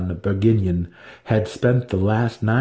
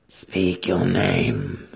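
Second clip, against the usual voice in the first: commanding; 2 semitones lower, much slower than usual. A narrator's reading. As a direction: order him like a ruling matron. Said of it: "Banray commanded".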